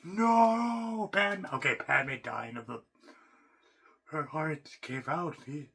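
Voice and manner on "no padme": mock anguish. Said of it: "You're like".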